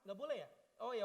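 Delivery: usual speaking voice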